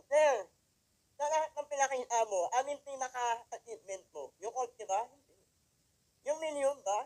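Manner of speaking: loud, high rhetorical questions to the crowd; loud preaching question. Acted. Speaker loudly asks the crowd what his biggest achievement is. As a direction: hammer the questions at the audience.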